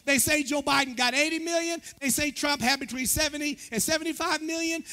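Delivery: loud, high, driving sermon delivery; preaching. Acted. Pastor tallies the nation's votes for the congregation, preaching. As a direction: preach it hard to the whole church.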